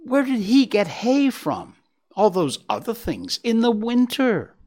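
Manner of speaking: vigorous asking. Acted. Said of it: "Faline asked Gobo vigorously".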